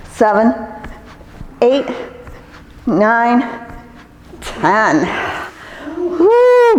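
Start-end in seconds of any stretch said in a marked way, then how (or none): 6.0-6.8 loud high woo after a hard set; excited whoop. Real, not acted. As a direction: whoop it out with relief. Shout after finishing set.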